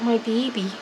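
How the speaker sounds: ordinary talk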